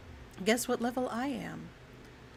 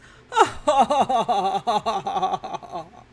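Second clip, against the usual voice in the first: put-on big old man laugh; belly laugh, louder; loud cackling laugh; 10 dB louder than usual.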